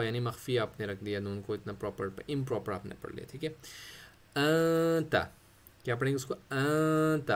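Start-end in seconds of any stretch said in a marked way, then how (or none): 4.4-5.2 आंता with the nun hidden in a nasal hum, not a clear n; nasal ghunna. Shown as a demonstration. Tajweed teacher showing students ikhfa on आंता, nasal hum. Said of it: "अब मैंने अंत नहीं पढ़ा". 6.3-7.3 आंता drawn out very slowly, nun hidden in a nasal hum; slow nasal ghunna. Shown as a demonstration. Teacher slowly modelling ikhfa for students, drawn-out nasal nun. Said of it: "अब मैंने अंत नहीं पढ़ा".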